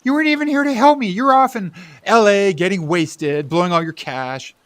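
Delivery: loud, high, rushing shout; furious accusation. Acted. Bill yelling at Richie for leaving him, furious. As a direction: let it all burst out at him.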